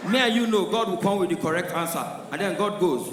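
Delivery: ordinary talk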